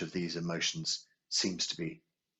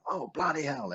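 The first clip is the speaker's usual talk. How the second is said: higher, half-hearted mock outburst; imitating own road rage; 9 semitones higher than usual. Speaker cursing other drivers like her dad, higher mock outburst. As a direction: toss off a pitched-up curse at a driver. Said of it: "every time a driver did something wrong".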